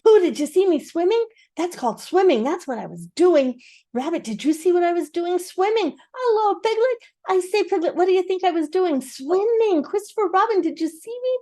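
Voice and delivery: high, loud eager question; excited squeak. A narrator's reading. Roo asking everyone excitedly about his swim. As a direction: burst with pride as a tiny joey.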